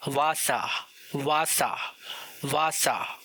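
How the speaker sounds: वासा with w spoken as v; वासा with v, not English w; German w sound. Shown as a demonstration. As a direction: start वासा on a v. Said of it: "इस letter की pronunciation है वे".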